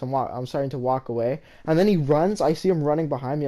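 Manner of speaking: ordinary talk, nothing marked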